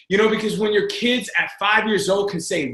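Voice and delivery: ordinary talk, nothing marked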